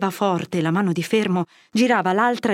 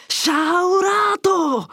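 a long, much higher, drawn-out cry of rebuke; an old friar's voice regaining its full power in a shout; thundering shout; 11 semitones higher, 3 dB louder, much slower than usual. A narrator's reading. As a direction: roar the word out, slowly and fully. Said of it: "gridò".

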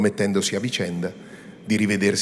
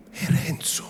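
È Renzo whispered, higher, shaking her head; whispered sorrow; 9 semitones higher than usual. A narrator's reading. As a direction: murmur È Renzo to herself. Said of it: "tentennando il capo". (È Renzo sussurrato, con rammarico)

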